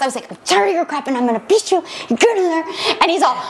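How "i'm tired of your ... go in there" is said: higher, rushed, strained mock scolding; fast whisper yelling. Mom reenacts threatening her tired son, whisper yelling fast. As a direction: rattle off a threat in a stifled yell. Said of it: "I was, like, whisper yelling really fast".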